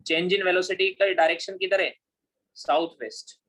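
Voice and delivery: ordinary talk, nothing marked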